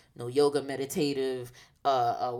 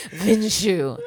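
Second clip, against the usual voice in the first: laughing; 7 semitones higher, 8 dB louder than usual. Real, not acted. Laughing at own poses.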